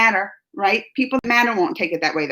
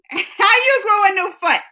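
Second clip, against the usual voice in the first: laughing; 10 semitones higher than usual. Real, not acted. Repeats line laughing.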